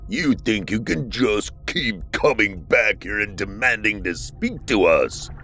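low, slow, challenging creature. Acted. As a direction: growl it, harder to follow. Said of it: "Their voice grew deeper and more challenging".

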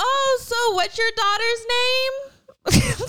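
high, slow, drawn-out question; mock polite probing. Mom asking about the crush's name, high mock-polite drawl. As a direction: stretch it out with put-on curiosity.

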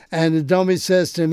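normal talking voice

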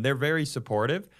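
normal talking voice

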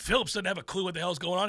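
ordinary talk, nothing marked

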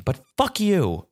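high, drawn-out indignant curse; pissed off. Real, not acted. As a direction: curse them slowly in a high voice. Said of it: "I'm pissed".

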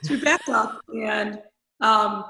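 normal talking voice

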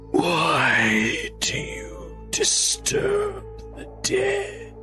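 rasping undead whisper; cold hissing layered whisper. Acted. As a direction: hiss it like a long-dead corpse. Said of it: "in a rasping voice".

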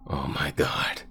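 breathless, shocked whisper; horrified whisper. Acted. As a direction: gasp it in horror. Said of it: "tears began to form".

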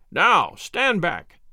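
commanding shout, drawn out slowly; slow, weighty order to a crowd; mighty voice. A narrator's reading. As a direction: call out with force to push a crowd back. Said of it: "in a mighty voice".

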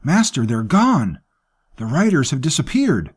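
out of breath from running, alarmed; breathless alarm. Acted. Servant bursts in to tell his master, breathless and alarmed. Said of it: "his breath huffing".

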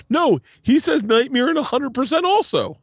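very high, drawn-out imitation of critic; mock insistence. Acted insistence of online critic.

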